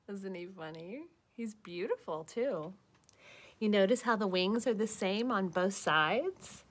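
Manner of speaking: plain reading voice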